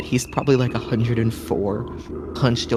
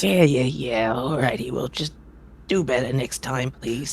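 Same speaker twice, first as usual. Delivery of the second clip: a gruff, impatient circus ringmaster scolding; 2 semitones higher than usual. Acted. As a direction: grumble at the late performer. Ringmaster cuts off.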